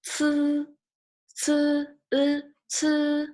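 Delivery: "ci" and "e" held high and level; aspirated ts opening into a sustained "e" in "ci"; ci with e sound. Shown as a demonstration. Teacher breaking down the syllable for learners, ci with e sound. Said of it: "but with a e sound".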